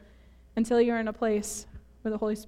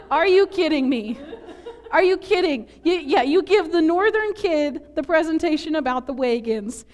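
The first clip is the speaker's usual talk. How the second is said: raised, louder mock outrage; comic indignation; 5 semitones higher, 4 dB louder than usual. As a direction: protest in exaggerated disbelief. Mock outrage at the school assignment.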